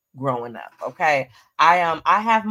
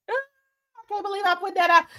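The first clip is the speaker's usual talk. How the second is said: giddy disbelief; 13 semitones higher, much faster than usual. Real, not acted. Excited reveal of hosting plans.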